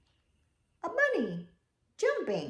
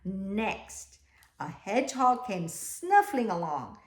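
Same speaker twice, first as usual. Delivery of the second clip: lively, louder read-aloud; storybook reading; 2 semitones higher, 6 dB louder than usual. A narrator's reading. Teacher reading the class a picture book, lively. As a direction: tell it with bounce.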